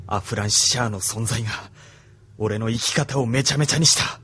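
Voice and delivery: ordinary talk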